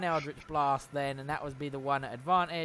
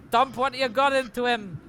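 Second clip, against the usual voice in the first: raised, higher voice calling to allies; in-character rallying call, pitched up; loud urgent order; 7 semitones higher, 6 dB louder than usual. Acted. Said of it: "and will loudly say".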